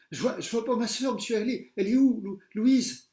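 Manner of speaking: high loud stammering cry; girl screaming for her lost sister; frantic worry. A narrator's reading. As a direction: call for her sister in panic. Pupil searching for sister.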